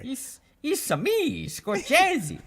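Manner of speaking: very high, Italian-style Mario squeal. Pretend. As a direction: belt it out like Mario. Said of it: "my impression of Chris Pratt as Mario".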